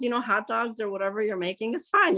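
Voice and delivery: usual speaking voice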